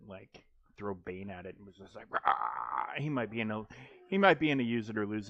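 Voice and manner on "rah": low, growly "rah" roar; low roar, thrown in playfully; mock roar. Mimics a roar at Bane throw.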